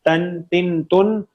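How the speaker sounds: teacher's model of تن with a, i, then u; each تن ends on a clear n; tanween drill. Shown as a demonstration. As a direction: say تن as tan, tin, tun. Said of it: "تنوین کی sound ہوتی ہے".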